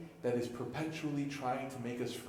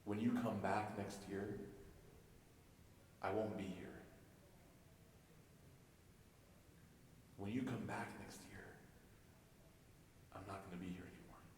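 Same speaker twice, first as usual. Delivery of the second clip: very soft, with long pauses; hushed farewell; 3 semitones lower, 15 dB quieter than usual. Acted. The Prophet tells Mu'adh he won't be here, hushed farewell. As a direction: break sad news quietly and gently.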